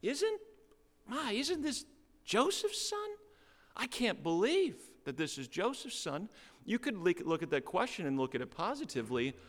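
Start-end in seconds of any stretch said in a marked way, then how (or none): 0.0-6.3 much higher, gasping disbelief; incredulous townsfolk. Pretend. Hometown crowd about Jesus, high incredulous squeal. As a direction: squeal of amazement at the local boy. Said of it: "his own hometown challenges him".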